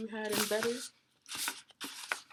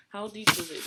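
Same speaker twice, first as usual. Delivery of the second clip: usual speaking voice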